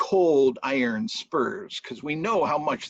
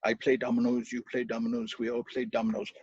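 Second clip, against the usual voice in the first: lightly voiced charm, low and hushed; low muttered charm; 5 semitones lower, 5 dB quieter, faster than usual. Brian Boru casting a charm on the stone, low murmur. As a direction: murmur the spell under the breath. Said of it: "put a charm on it".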